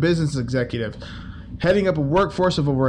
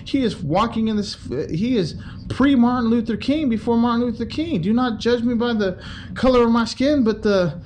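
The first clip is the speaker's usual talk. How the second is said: higher, gushing fake admiration; mock praise; 7 semitones higher than usual. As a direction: oversell Capone as a civil rights hero.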